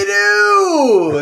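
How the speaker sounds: high, loud, slow drawn-out shout; mock drunk yell. DJ imitating a drunk partygoer shouting a request. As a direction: wail the name like a drunk partygoer.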